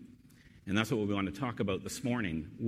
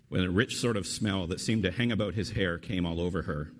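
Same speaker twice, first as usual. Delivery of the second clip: normal talking voice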